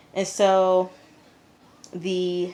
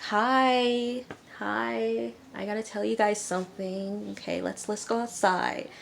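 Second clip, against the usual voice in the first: Alyssa's voice; 6 semitones higher than usual. Retold or imitated. Alyssa greeting her parents.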